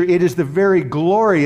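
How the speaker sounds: ordinary talk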